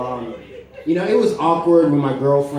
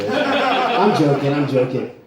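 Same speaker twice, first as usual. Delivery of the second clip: laughing; 3 dB louder, slower than usual. Real, not acted. Comic laughing it off after the piss joke. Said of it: "laughing it off".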